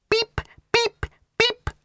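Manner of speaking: very high electronic beeping; imitated beeps. Ford imitating the speaking clock's beeps, very high. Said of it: "said Ford".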